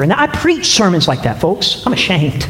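normal talking voice

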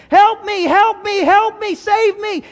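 high, loud, frantic cries for help; hammed-up shriek of a man in a gorilla suit facing a lion; panicked scream for help. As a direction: scream it like you're about to be eaten. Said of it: "the gorilla starts to scream".

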